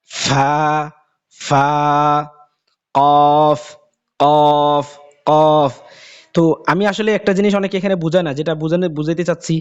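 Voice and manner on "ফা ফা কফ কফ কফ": emphatic letter drill. Shown as a demonstration. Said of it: "এরপরে ফা".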